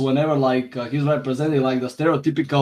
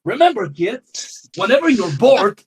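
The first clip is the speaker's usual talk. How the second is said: high, hammed-up cartoon announcer; silly public service voice, much higher; mock kids' PSA; 9 semitones higher, 3 dB louder than usual. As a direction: preach nonsense to kids in a cheery cartoon voice.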